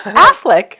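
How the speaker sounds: "Affleck" as AFF-leck, first syllable stressed; corrected Affleck. Shown as a demonstration. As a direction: slowly show "Affleck" with a strong open A first. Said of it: "Ben Affleck".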